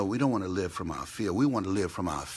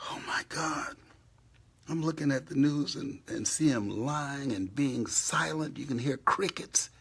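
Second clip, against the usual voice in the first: ordinary delivery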